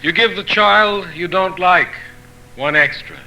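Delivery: measured proverb recital, each phrase starting high and falling at the end; poetry reading. Acted. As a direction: read each saying in separate phrases, pausing between them. Poet reads translated proverbs aloud.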